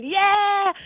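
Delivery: sharp high cheer of "Yeah!"; retold crowd shout. Imitating a crowd cheering on a politician. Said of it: "who shouted".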